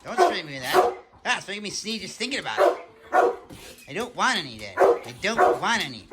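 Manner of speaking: panicked pleading as the dog, loud and high; yelping protests as the dog dodges cologne. Pretend. Dog dodging cologne spray.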